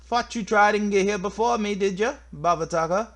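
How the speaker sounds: high, loud, put-on Scottish accent; aggressive Scottish accent. Telling off a rival who got here first, aggressive Scottish accent. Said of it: "what is with this aggressive, like, Scottish accent".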